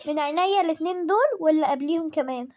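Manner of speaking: ordinary delivery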